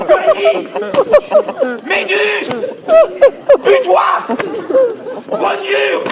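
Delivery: yelling insults, pitch flying up; furious shouting. Acted. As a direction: chase her out screaming. Husband chasing the witch out.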